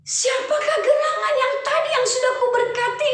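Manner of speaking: put-on a startled old father realizing the mix-up; confused old Isaac. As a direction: fumble the words in alarm.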